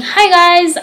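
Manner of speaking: high-pitched greeting. Retold or imitated. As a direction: mimic your own high-pitched hello. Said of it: "like, high-pitched".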